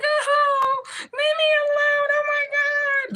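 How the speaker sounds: high, loud, slow crying plea; mock terrified wail. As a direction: ham up a frightened victim begging spirits. Mocking a scared person.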